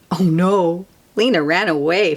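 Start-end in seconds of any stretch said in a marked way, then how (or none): none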